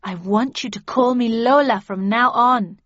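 loud, firm insistence; insistent correction. A narrator's reading. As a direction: lay down the law about your name. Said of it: "she corrected me".

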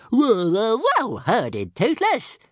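high, loud squeaky dragon; little dragon voice. A narrator's reading. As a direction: chirp it like a proud dragon. Dragon praises himself.

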